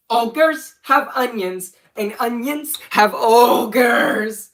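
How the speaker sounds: loud, high, drawn-out catchphrase; hammed-up goofy shout with stretched words; shouted video catchphrase. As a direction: belt out the silly cartoon line slowly. Performs line from own old video.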